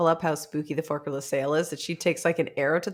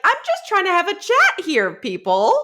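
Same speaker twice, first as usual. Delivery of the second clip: high, put-upon plea; hammed-up annoyed politeness; mock polite exasperation; 11 semitones higher, 4 dB louder than usual. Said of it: "casual murder to be like".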